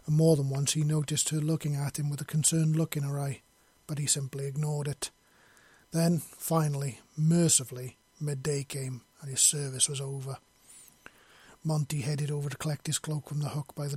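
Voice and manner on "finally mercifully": ordinary talk, nothing marked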